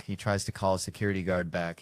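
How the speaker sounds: ordinary talk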